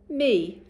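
me said loud and high; modelled tricky word. Shown as a demonstration. Teacher models me for the children to repeat, loud. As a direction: pitch me up loud for the class to copy. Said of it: "My turn".

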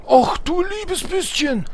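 hammed-up whispered "oh dear me", high and slow; whispered mock dismay, drawn out; mock old-lady gasp. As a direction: play a shocked little granny.

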